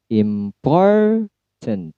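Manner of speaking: Important, POR high and held long; careful demo of word stress on Important. Shown as a demonstration. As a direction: say "Important" slowly with POR lifted. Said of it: "iramanya seperti ini".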